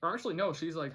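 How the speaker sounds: usual speaking voice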